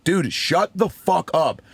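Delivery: high, slow, emphatic exasperation; losing his cool. Retold or imitated. Comic to a screaming heckler, retold loss of cool. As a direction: draw out each word, retelling a fed-up outburst. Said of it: "and just lost my cool".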